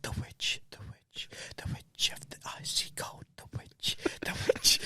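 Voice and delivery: whispered, timid chant; barely audible, imitating a small backup singer; hushed backup singer. Teasing co-host as little boy at the microphone, whispered chant.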